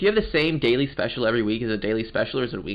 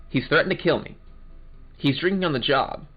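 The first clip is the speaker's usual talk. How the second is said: ordinary talk, nothing marked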